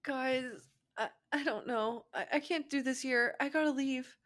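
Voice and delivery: lightly voiced upset teen; Sakura's voice. Sakura's distraught line.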